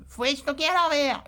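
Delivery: mild mock bossy tip. Mocking the common advice, mild bossy tip. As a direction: toss off the stock rule. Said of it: "Donc lui".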